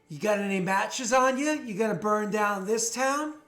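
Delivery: loud, high heckle, drawn out at the end; jeering. Acted. Thug goads the party about burning the town, jeering shout. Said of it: "they're jeering and".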